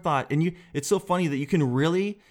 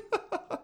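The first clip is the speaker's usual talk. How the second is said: breathy laugh; 8 dB quieter than usual. Real, not acted. Laughs at himself.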